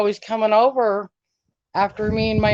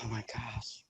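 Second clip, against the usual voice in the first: breathless whispered oh my gosh; barely voiced, stunned whisper; whispered gasp; 11 semitones lower, 20 dB quieter than usual. Real, not acted. Stunned reaction to question.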